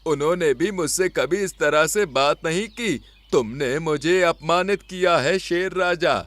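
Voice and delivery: mildly angry elder bear declaring he is leaving; angry old bear. Acted. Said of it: "क्रोधित होकर बोले". (आहत, नाराज़ बूढ़ा भालू)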